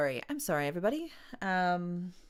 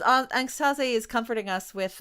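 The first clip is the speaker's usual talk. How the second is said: normal talking voice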